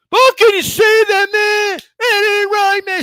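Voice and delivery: high, whiny fake sobbing protest; mock crying fan. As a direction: wail it like a hurt fan, hammed-up.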